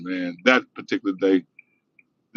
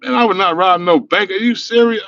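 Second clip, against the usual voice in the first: incredulous; 9 semitones higher, 4 dB louder than usual. Retold or imitated. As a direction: act as if the idea is absurd. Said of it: "I was like".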